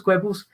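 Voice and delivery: "Goebbels" said as KWEB-ulz; alternative pronunciation. Real, not acted. Commentator puzzling over the name "Goebbels" as KWEB-ulz, unsure. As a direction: try "Goebbels" with a kw start and short e. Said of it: "Whichever way you want to pronounce it".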